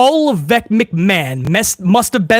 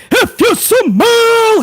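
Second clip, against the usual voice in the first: The Rock impression; much slower than usual. Quoting The Rock's catchphrase.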